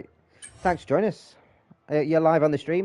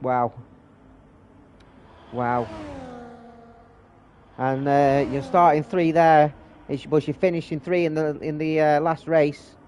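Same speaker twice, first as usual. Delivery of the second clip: race commentary; 3 semitones higher, 4 dB louder than usual. Real, not acted. Live race play-by-play.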